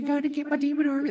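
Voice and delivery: high, fast giddy villain chant. Acted. Pitching a movie, villain giddily repeats his plan, high and fast. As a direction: gloat like a giddy kid with a new toy. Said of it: "he's just in a giddy mood".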